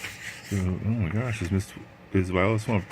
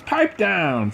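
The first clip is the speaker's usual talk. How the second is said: 1 stern coach; 10 semitones higher than usual. Acted. Coach silences complaining team.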